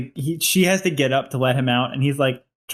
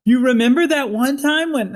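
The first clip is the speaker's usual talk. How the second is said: much higher and louder, but not angry; really loud, not angry; 11 semitones higher, 4 dB louder than usual. Acted. Justin saying it loudly at dinner because Tom asked, loud and not angry. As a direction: belt it out, awkward not furious. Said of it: "really loud and everybody's just".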